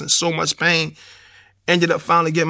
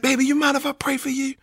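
higher, softer caring nurse; gentle nurse voice; 9 semitones higher, 4 dB quieter than usual. Retold or imitated. Nurse at his hospital bed offering to pray, high and soft. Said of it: "she was like".

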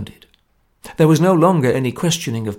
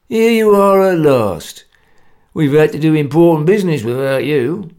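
loud hearty greeting; 2 semitones higher, 6 dB louder than usual. Acted. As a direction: boom it out cheerfully. Gunnar greets loudly.

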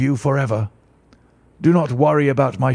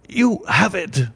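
breathless, higher, slow joyful gasp; 6 semitones higher, slower than usual. Acted. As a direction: gasp it out in relief. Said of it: "he gasped".

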